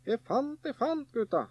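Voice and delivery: high-pitched gleeful calling out; boy calling his brothers about a find; excited call. A narrator's reading. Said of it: "ropte han".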